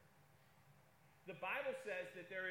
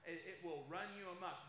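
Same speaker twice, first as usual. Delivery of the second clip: ordinary delivery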